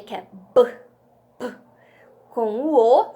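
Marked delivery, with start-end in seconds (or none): 0.5-1.5 the bare sound of "B", not the letter name; som do B. Shown as a demonstration. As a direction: pop "B, P" as short isolated consonant sounds. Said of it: "do som do B". (som curto e isolado do "B")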